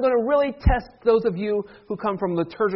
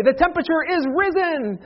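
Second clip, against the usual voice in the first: high, grand liturgical announcement; hammed-up formal declaration; mock proclamation; 8 semitones higher than usual. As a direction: proclaim everyday news like Easter liturgy. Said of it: "you don't say that".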